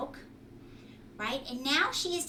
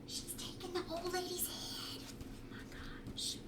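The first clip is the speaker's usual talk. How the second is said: hushed excited whisper; 7 semitones higher, 10 dB quieter than usual. Real, not acted. Excited aside to children.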